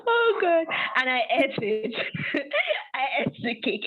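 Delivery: high "oh God" dissolving into real laughter; laughing confession. Real, not acted. Storyteller admitting she ate the cake, laughing. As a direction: giggle helplessly through the admission.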